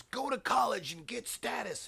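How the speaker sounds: high, loud parental order; nagging parents. Acted. Parents ordering their teenager off to college, nagging loudly. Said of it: "our parents were telling us".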